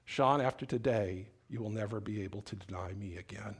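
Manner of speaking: low, soft, measured voice of God; Holy Spirit speaking. Retold or imitated. Preacher voices the Spirit speaking to Sean's heart, quiet and solemn. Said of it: "the Holy Spirit starts speaking to his heart".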